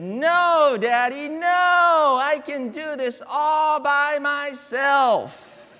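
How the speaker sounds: put-on high, loud, slow child's cry of protest; child screaming. Pastor voicing his toddler refusing help. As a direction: play the toddler insisting on doing it herself. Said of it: "She screamed at me".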